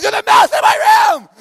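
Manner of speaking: panicked yell. Retold or imitated. Camp speaker retelling fleeing the mouse to kids, high panicked yell. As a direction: shriek it like you just saw the mouse. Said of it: "I'm yelling and screaming".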